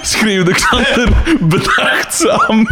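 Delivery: slow, high, cracking up while reading; helpless laughing. Real, not acted. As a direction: squeeze the words out through real laughter. Cracks up rereading absurd line. (gierend van het lachen, traag)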